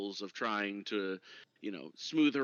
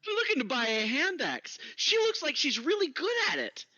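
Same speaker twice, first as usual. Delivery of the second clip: raised dwarf voice; cheerful dwarf, pitched up; high dwarf voice; 5 dB louder than usual. Acted. Dwarf character speaks.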